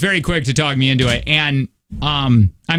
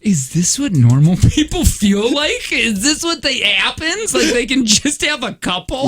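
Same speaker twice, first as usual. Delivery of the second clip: mock amazement; 8 semitones higher than usual. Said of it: "and I go".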